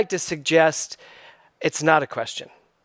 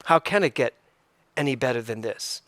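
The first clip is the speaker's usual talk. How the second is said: normal talking voice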